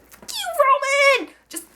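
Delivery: high-pitched, exasperated fan rant; annoyed outburst. Real, not acted. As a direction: shriek it like a fed-up fan. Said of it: "I'm getting so annoyed".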